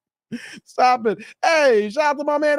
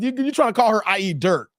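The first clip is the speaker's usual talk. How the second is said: ordinary delivery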